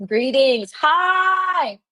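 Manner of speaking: high, drawn-out hello; excited greeting. Real, not acted. Greeting someone who has just arrived.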